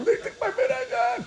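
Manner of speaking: high-pitched whiny fake wail; mock crying. Esav crying to CNN over the Berakhah, mock wailing. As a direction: wail it with crocodile tears, very high. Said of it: "you were crying to C N N".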